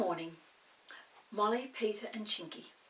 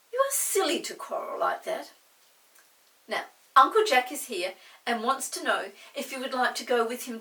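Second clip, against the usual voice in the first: louder kindly mother; mother's voice; 3 semitones higher, 10 dB louder than usual. Acted. Mother offers the farm trip, kindly. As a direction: speak like a mother cheering them up. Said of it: "Mother looked in at the door".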